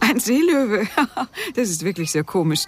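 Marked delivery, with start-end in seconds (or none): 0.0-0.9 amused disbelief. Acted. Amused disbelief at sea lion. 0.9-1.5 short high laugh. Acted. Laughs at sea lion remark.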